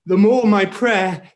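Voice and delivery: ordinary delivery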